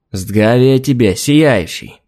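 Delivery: slow alien speech with rolled-back R; slow, strange alien greeting with a burred R. Acted. Grey alien greets captain.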